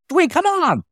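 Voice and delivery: high, drawn-out warning "Dwayne."; scolding owner. Retold or imitated. Club owner warning the comic not to swear, high scolding call. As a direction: call his name as a sharp high warning.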